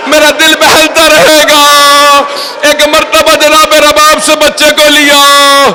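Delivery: high, impassioned mourning narration, climbing to a cry; sustained wail with long-held line endings; lamenting preacher. Acted. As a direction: tell the tragedy in a keening, stretched voice. Recounts tragedy in lament voice.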